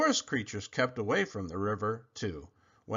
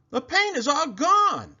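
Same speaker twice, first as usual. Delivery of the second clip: relieved crocodile; 6 dB louder than usual. Acted.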